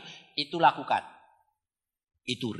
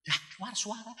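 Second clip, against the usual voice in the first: ordinary delivery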